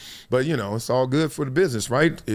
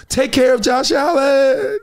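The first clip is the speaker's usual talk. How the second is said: higher, louder, slow mock wail; mock pleading shout; 10 semitones higher, 3 dB louder, slower than usual. Host mocking the league's protection of Josh Allen, slow pleading shout. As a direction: wail it like a desperate plea to protect him.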